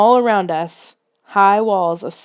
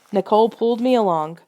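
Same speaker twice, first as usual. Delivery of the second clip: ordinary delivery, nothing marked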